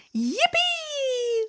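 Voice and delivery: very high, excited yippee; joyful cheer. Acted. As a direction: squeal with delight. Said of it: "He shouted".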